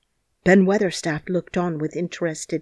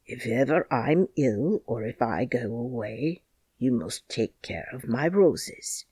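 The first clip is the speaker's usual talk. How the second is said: a hint of a gentle lady asking a favour; remembered young mother, mildly voiced; gentle young woman; 5 semitones lower than usual. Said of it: "says she, laughing".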